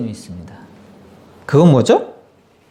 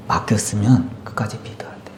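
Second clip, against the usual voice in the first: whispered to himself; soft whispered resolve; 5 semitones lower, 6 dB quieter than usual. Real, not acted. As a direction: breathe the words out unvoiced, like a private vow.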